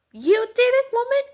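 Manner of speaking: mock accusing shout. Imagined harsh Jesus accusing woman.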